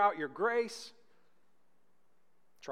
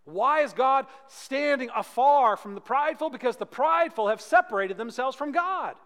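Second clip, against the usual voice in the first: loud, higher, pressing faster; full-force preaching; 7 semitones higher, 9 dB louder than usual. Acted. Preacher confronts prideful hearts, loud and rising. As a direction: drive the point at the congregation.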